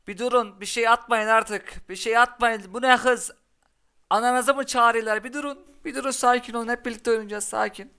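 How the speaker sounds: high, loud mock begging; mock plea. Real, not acted. Streamer to the opponent's fast attacks, high mock plea.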